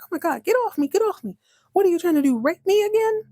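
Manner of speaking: high-pitched mock alarm; hammed-up outrage, pitch jumping way up; alarmed protest. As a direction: imitate someone fending off a grab. Acted struggle against a grab.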